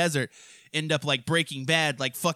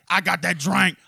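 imitating a loudmouth; 6 dB louder than usual. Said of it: "he's saying this shit in front of people".

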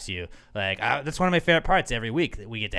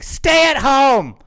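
stretched out, much higher and louder, like a grand proclamation; mock dramatic slogan; 12 semitones higher, 12 dB louder, much slower than usual. Mocking his own profile picture, he proclaims it hammed-up and slow. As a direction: boom it out slowly like a public service slogan. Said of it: "my profile picture saying".

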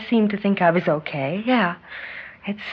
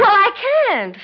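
defensive; 13 semitones higher, 3 dB louder, slower than usual. Acted. Defensive insistence.

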